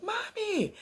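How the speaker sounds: put-on very high little girl's call; child calling out. Little girl calling after her mother, high mock cry.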